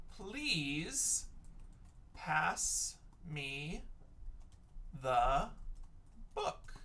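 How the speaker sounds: word by word with long gaps; slow dictation. Shown as a demonstration. Teacher gives her sentence for please, word-by-word dictation. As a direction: pause after each word as if writing.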